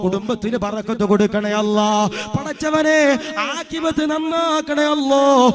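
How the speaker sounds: loud, slow, pleading prayer chant; rising loud supplication; fervent dua. Acted. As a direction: beg God's blessing for the expatriate brother.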